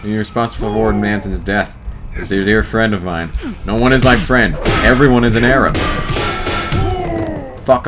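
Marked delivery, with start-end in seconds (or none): none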